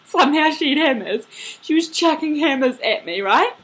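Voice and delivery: high, loud laughing delivery. Real, not acted. Dreamer telling how the lady chucked hammers, laughing. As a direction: laugh through the absurd detail.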